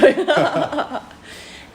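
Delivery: bright, high burst of laughter. Real, not acted. Laughs after accent joke.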